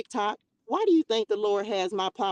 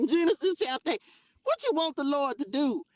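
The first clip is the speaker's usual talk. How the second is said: ordinary talk, nothing marked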